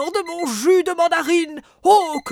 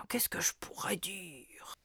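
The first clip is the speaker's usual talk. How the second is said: whispering to himself; 6 semitones lower, 15 dB quieter than usual. Acted. Muttering to himself while writing.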